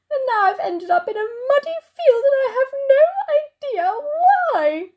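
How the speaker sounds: high, rising plaintive lament; distressed wail. Acted. Chair dumped in a muddy field, distressed wailing. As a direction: wail it in despair, pitched high.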